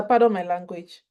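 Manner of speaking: ordinary talk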